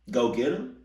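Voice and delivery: questioning repeat with rising end; incredulous echo. Acted. Reactor echoing mom's order in disbelief, rising question. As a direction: throw the order back as a question.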